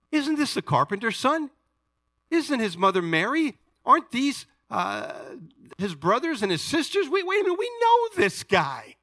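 high-pitched mock disbelief, rising to a shout; incredulous townspeople. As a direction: play the scoffing locals who know him. Acting out doubting hometown crowd.

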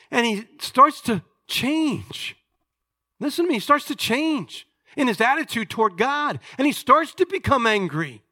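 high, loud, impassioned sermon; fervent preacher building to a climax; full-force preaching. Real, not acted. Preaches Job's turn with rising intensity.

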